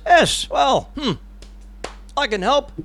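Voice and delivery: a hint of an obliging monarch; lightly voiced king. English king offers Scotland his help, lightly acted. Voicing King Edward in the retelling.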